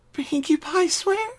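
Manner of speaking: high, slow small voice; timid question. Acted. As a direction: ask hopefully, very small.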